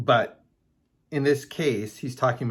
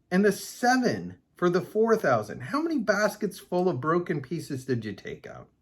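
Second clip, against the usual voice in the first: mildly exasperated, higher-pitched question; exasperated quizzing; 7 semitones higher than usual. Acted. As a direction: quiz them as if they should know this. Reads Jesus quizzing the disciples.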